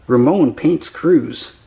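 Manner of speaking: ordinary delivery, nothing marked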